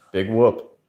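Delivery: normal talking voice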